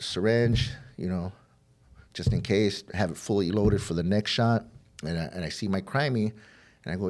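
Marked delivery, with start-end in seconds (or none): none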